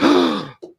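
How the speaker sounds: loud theatrical gasp; hammed-up intake of horror; mock shocked gasp. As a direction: gasp as if scandalized. Acting out critics' shocked reaction.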